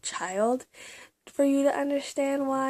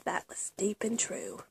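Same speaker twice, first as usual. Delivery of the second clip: soft whisper, much softer; whispered comment; 3 semitones lower, 9 dB quieter than usual. Real, not acted. Commenting on her own journal quote, whispered. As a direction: murmur it like a secret.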